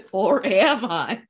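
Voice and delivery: loud, drawn-out mimicry; mock echo. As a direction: parrot the line back, big and slow. Mimics the other host's quip.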